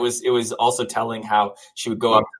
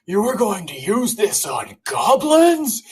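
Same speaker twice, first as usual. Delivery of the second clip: incredulous ogre; 10 semitones higher, slower than usual. Retold or imitated. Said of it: "the ogre says".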